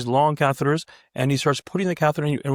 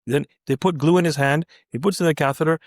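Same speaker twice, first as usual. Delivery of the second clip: ordinary delivery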